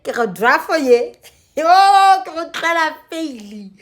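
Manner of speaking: ordinary talk, nothing marked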